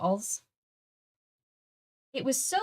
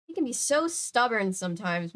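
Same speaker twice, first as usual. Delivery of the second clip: usual speaking voice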